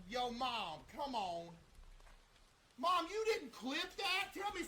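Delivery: high, loud and slow call; calling out to mom. Real, not acted. Streamer calling his mom to ask if she clipped it, high and loud. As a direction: call across the house to mom.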